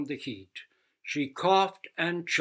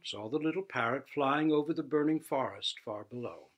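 usual speaking voice

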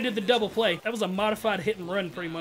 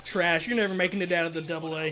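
usual speaking voice

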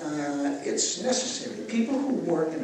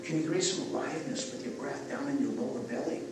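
soft, hushed breathing-exercise guidance; guided breathwork; 5 semitones lower, 8 dB quieter than usual. Acted. Guiding the group through breathing, soft and hushed. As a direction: talk them gently down into the belly.

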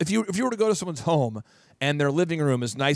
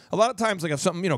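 ordinary talk

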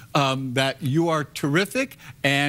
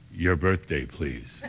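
very low, dropping to near silence on please; flat bored clerk demand; deadpan clerk; 11 semitones lower, 5 dB quieter, much slower than usual. Retold or imitated. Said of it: "So he said".

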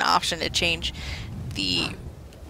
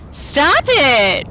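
loud, high-pitched protest; exasperated yell; 6 semitones higher, 8 dB louder than usual. Real, not acted. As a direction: shout at them to quit. Yells at enemies in game.

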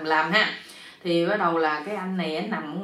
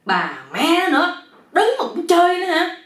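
exasperated yell; 10 semitones higher, 6 dB louder than usual. A narrator's reading. Sleepless man cursing mosquitoes while standing, exasperated yell. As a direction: shout it high, loud and outraged. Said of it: "ảnh la nữa".

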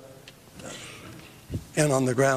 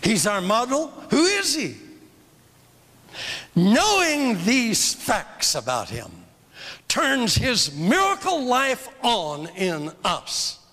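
preaching at full force; 4 semitones higher, slower than usual. Real, not acted.

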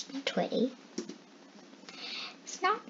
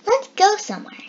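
high, quick and louder, eager to go; eager call; 9 semitones higher, 7 dB louder, faster than usual. A narrator's reading. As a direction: Boom pipes up to get moving. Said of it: "said Boom".

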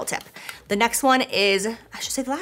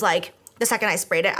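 ordinary delivery

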